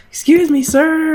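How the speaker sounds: very high, loud shout to a waiter; loud call. Retold or imitated. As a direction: yell for the waiter at the top of your voice. Old lady calling out again.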